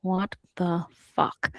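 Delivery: slow, low, stunned what the fuck; stunned villain. Retold or imitated. Villain frozen in place, low and slow stunned. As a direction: let it drop out of him slowly, dumbfounded.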